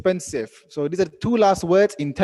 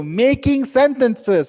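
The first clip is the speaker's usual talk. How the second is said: game show announcement; 9 semitones higher, 5 dB louder, slower than usual. Real, not acted.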